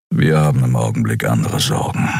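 low grumble, a lightly voiced brooding beast; grumbling. A narrator's reading. Kero brushing off Xea, low grumble. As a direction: rumble it to close the topic. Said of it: "brummte er".

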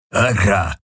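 burped out like a coarse alien brute; belched word. A narrator's reading. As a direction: let the word come up as a belch. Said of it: "rülpste der Vierarm". (gerülpst, wie ein grober Vierarm)

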